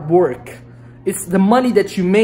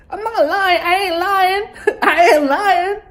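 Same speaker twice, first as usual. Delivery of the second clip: very high, drawn-out comic squeal; mock protest; 11 semitones higher than usual. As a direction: protest in a silly high voice, breaking into laughter. Comic protest of honesty, repeated.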